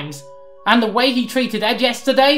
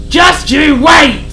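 slow, much louder yelled threat; drawn-out shout; 8 semitones higher, 8 dB louder, much slower than usual. Acted. Sid's final shouted threat at Harry. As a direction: bellow it out for the last word. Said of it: "Sid continued to rage".

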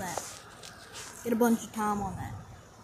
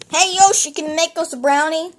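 loud, raised call across the house; 4 semitones higher, 12 dB louder than usual. Acted. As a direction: holler a request to another room. Calls out to Yoshi for food.

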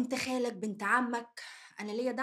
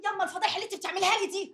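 rapid, higher yelling scold; angry fast shout; 7 semitones higher, faster than usual. Acted. Daughter scolding her mother for the scandal, shouting fast. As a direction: fire it off in a fast shriek of shame.

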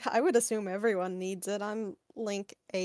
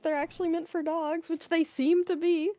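indignant; 8 semitones higher, 8 dB louder than usual. Real, not acted. Outraged about chocolate dog food.

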